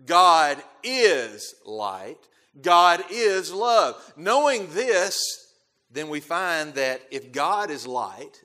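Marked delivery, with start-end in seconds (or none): 0.0-4.0 very slow and loud, "is" held long; stress on IS. Shown as a demonstration. Preacher declares God's nature to the congregation, stress on "is". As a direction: proclaim it, leaning hard on "is". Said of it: "I emphasize the word "is".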